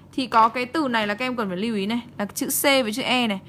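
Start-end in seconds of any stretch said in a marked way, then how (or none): none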